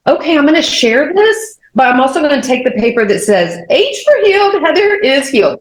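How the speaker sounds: high-pitched voicing of own thought; playful bargaining. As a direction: lift it high, lightly acting out a resolve. Acting out her own bargaining thought.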